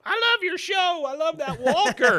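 high, loud excited fan scream. Retold or imitated. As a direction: shriek it like a starstruck fan. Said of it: "just screaming at him".

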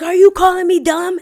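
loud, high outraged customer imitation; flipping out. Customer flipping out at barista, loud mock outrage. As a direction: shout it as the offended customer. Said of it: "man flips out".

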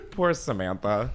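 normal talking voice

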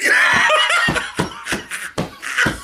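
shrieking burst of laughter. Real, not acted. Big laugh.

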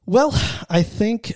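normal talking voice

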